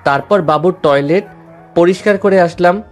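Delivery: ordinary talk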